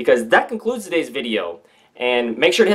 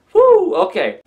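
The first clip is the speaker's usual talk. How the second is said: amazed whew; 4 dB louder, slower than usual. Real, not acted. Reacting to striking report.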